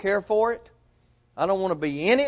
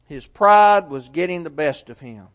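usual speaking voice

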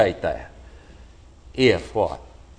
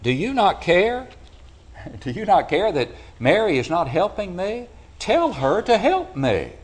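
complaining Martha; 8 semitones higher than usual. Retold or imitated. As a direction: whine to the Lord that your sister won't help. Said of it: "Because she said".

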